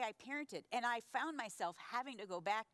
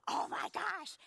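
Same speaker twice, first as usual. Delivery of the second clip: high, loud gasp of indignation; gasp, then exclaim high as if scandalized; retold outrage; 10 semitones higher, 5 dB louder, slower than usual. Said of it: "And I'm so mad".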